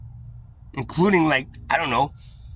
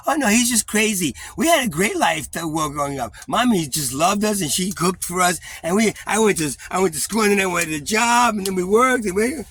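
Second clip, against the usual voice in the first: higher and louder, sing-song fake cheer; mocking family denial; 8 semitones higher, 6 dB louder than usual. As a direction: parody their sunny denial.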